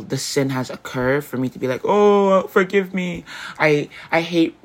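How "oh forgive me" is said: higher, louder mock plea for forgiveness; a hammed-up cry for mercy. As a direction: throw up a quick, hammed-up sorry to God. Mock quick repentance.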